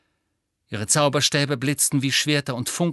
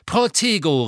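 sharp raised shield spell; called spell; 5 semitones higher, 4 dB louder than usual. A narrator's reading. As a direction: call the shield quickly.

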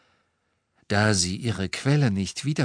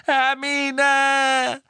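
very high, drawn-out desperate scream; full-voiced frantic roar; 5 dB louder than usual. A narrator's reading. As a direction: scream the name in anguish. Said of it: "brüllte Ron".

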